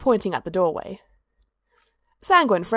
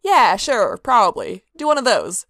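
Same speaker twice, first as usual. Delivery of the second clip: brash careless prince; 3 semitones higher, 5 dB louder than usual. A narrator's reading. Sanguine brushing it off.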